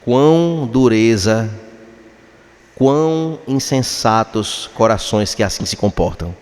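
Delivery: usual speaking voice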